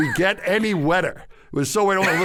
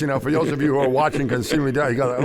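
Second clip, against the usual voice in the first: plain reading voice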